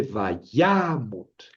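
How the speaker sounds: vayamot with a long, stressed middle YA; va-YAA-mot, the middle syllable stretched. Shown as a demonstration. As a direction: lean on the YA of vayamot. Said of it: "el acento cae en la, aquí".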